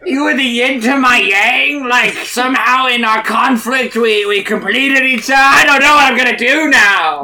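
high, loud sobbing speech; blubbering eulogy. Acted. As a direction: weep through a mock eulogy. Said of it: "He's just like".

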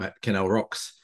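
ordinary talk

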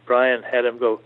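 ordinary delivery

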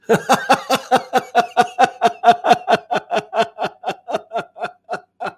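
loud, high, sustained belly laugh; forced hearty laughter. Shown as a demonstration. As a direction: laugh on purpose, big and long. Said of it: "Here we go".

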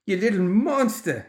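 high-pitched, outraged holler. A narrator's reading. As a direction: holler it while reeling from blows. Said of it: "he hollered, staggering".

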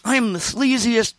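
imitated brazen pride; proud boasting. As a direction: proclaim it like a badge of honor. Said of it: "And I'm proud of it".

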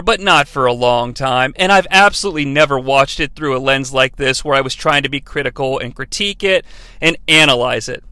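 usual speaking voice

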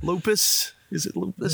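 high, whiny neurotic sitcom voice; George Costanza. Retold or imitated. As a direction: panicky high-pitched sitcom worrying. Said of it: "I feel like George Costanza now".

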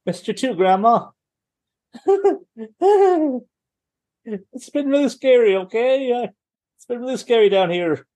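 put-on high, small, scared child voice; childlike voice. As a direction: play it like a frightened kid. Child voice to monster grandma.

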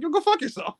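high, giggling go fuck yourself; retelling it while cracking up; laughing insult. Retold or imitated. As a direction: laugh through the retold insult.

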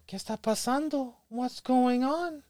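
high, slow, startled mother voice; Spanish-speaking mother caught off guard, slow and high; mom reenactment. Retold or imitated. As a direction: play the confused mom, high and drawn out. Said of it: "Good reenactment".

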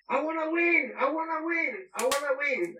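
high-pitched mock shout, insistent; imitated shouting. Former player imitating his manager who wants to win, shouting high. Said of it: "and he was shouting".

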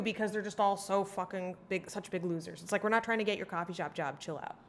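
ordinary delivery

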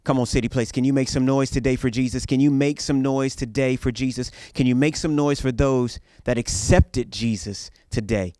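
loud rallying call to the crowd; rally speech. Acted. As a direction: whip the crowd into cheering. Rallying congregation to cheer.

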